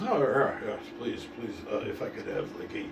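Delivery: soft near-whisper, almost no voice; breathy whispered Tuvok. Retold or imitated. Comic retelling of Tuvok ordering a sandwich, near-whispered. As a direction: whisper the order timidly like a meek celebrity. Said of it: "I don't know how he talks. I think he talks like that".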